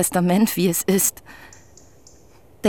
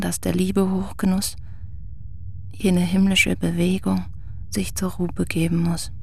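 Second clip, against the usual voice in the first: poem recital; 3 dB quieter than usual. Acted. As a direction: recite it gently, letting lines fade to breath. Reads the poem aloud.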